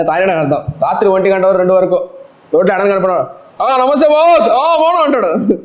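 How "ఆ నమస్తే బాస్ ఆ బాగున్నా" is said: put-on drunk greeting. Drunk neighbour greeting passersby.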